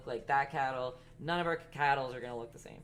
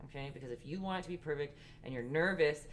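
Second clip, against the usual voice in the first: ordinary delivery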